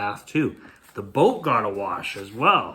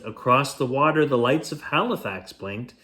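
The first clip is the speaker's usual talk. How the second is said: usual speaking voice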